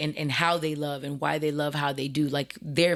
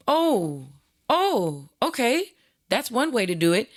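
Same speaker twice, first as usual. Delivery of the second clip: stunned surprise; 5 semitones higher than usual. Retold or imitated. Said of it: "And I'm like".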